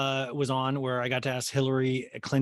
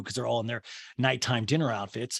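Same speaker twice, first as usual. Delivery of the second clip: ordinary talk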